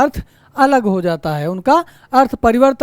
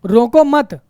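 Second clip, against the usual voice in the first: "रोको मत" run together and closed off after मत; no break between रोको and मत; रोको मत as one unit; slower than usual. Shown as a demonstration. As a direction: join मत onto रोको, then stop. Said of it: "अगला क्या है".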